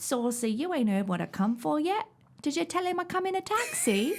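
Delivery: theatrical Cockney, rising to a high finish; Cockney accent. Acted. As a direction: play a saucy stage Cockney. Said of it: "the My Fair Lady monologue is".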